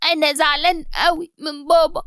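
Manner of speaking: put-on a boy's voice through tears; tearful child. Son Ali telling her he is upset, crying. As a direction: sniffle it out hurt. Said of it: "وقال بدموع".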